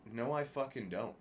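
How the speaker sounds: ordinary delivery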